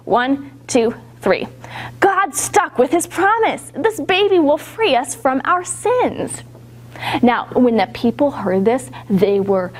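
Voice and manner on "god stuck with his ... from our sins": high-pitched, excited announcement; really excited voice. Shown as a demonstration. Storyteller leading children as Anna so excited about this, excited voice. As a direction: burst out with the good news, pitched up. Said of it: "in a really excited voice".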